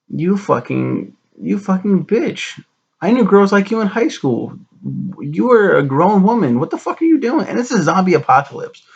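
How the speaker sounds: high-pitched exasperated tirade at a TV character; exasperated rant. Real, not acted. Fan ranting at Lori on screen, high exasperated outburst. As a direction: yell at Lori like she can hear you.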